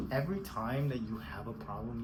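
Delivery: ordinary talk, nothing marked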